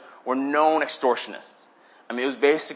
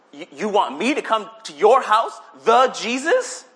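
higher-pitched, stammering disbelief; incredulous, slowing on the big name; disbelieving Zacchaeus; 5 semitones higher, 4 dB louder than usual. Acted. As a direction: question it as if you can't believe the honor. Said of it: "and he's like".

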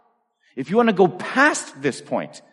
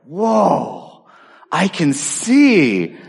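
slow, wondering whoa; amazed; 3 semitones higher, much slower than usual. Retold or imitated. Boy in class with new glasses, slowly and amazed. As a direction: marvel at seeing for the first time.

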